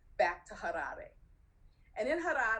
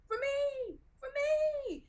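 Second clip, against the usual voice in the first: very high, drawn-out squeal; acted thrilled outburst, held long and high; elated cry; much slower than usual. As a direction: sing it out high, overjoyed. Acts out own elated reaction.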